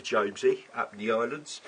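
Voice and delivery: put-on a Scottish accent slipping into Cockney; half-hearted Scottish accent gone Cockney; botched Scottish accent. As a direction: try a Scottish accent and let Cockney creep in. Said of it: "that's Scottish with a Cockney accent".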